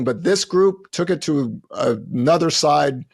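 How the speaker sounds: ordinary talk, nothing marked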